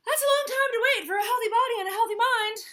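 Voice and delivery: fast, high, loud lecture; preachy Hippo. Acted. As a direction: scold him for waiting.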